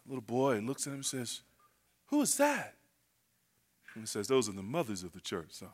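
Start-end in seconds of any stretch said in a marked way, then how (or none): 2.1-2.8 put-on high, soft child's question; small hushed voice of a curious boy. Little boy asks his dad in church, hushed and curious. 4.3-5.7 very low, soft fatherly answer; hushed father. Acted. Dad explains to his son during service, low and hushed.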